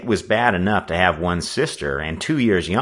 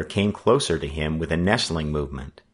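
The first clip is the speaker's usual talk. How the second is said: ordinary delivery, nothing marked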